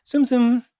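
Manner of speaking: loud, high nonsense chirp; Simlish gibberish. Shown as a demonstration. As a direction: echo the made-up syllables high and loud. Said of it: "actual words are gibberish".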